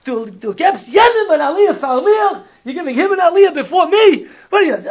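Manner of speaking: shouting that he was passed over for an aliyah; retold furious screaming. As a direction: rant at the gabbai, voice cracking with rage. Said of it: "he's screaming at the guy".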